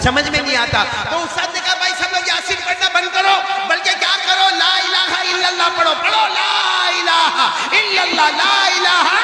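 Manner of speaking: high, sustained ringing exhortation; impassioned preaching. Real, not acted. Teacher leading students in the kalima at a deathbed, impassioned. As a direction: urge everyone to chant along, pitched high.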